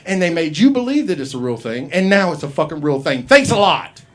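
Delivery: loud, high comic rant; mock-angry telling-off; exasperated scolding. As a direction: scold them loudly, punching each accusation.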